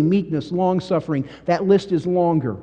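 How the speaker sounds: ordinary delivery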